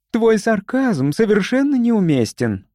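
high-pitched reproach; indignant. A narrator's reading. Эрика rebuking a colleague's sarcasm, indignant. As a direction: object indignantly, pitch well up. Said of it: "возмутилась была Эрика".

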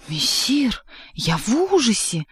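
high-pitched, hammed-up howl of dread; theatrical mock terror; wailing in feigned horror. A narrator's reading. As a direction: wail as if pretending to be horrified. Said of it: "завыл кот, изображая ужас".

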